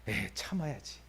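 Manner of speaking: soft, under the breath self-restraint; calm yourself down quietly after the outburst; holding it in. Pretend. God swallowing his anger at his people, soft self-talk. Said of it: "화나려고 하다가".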